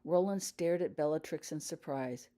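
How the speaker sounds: ordinary delivery, nothing marked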